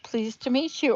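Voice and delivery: normal talking voice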